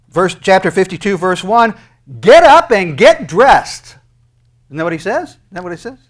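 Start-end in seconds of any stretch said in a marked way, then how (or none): 2.2-4.0 slow shouted command. Acted. Preacher shouting God's command.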